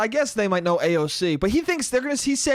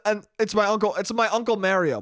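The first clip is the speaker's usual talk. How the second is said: ordinary talk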